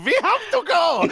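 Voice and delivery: loud high panicked cry; mock panic as the disguised pair run; panicked shout. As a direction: yell it while fleeing the party.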